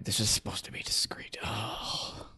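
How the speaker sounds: urgent hushed whisper. Acted. Urging them in discreetly.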